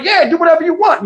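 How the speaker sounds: John Moss yelling okay from far off; careless shout. Retold or imitated. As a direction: wave them off with a yell.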